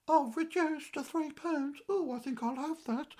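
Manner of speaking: high-pitched mimicked woman customer; rival shopper. Witch snatching the reduced chicken, mimicked rival shopper. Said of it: "And I heard her saying".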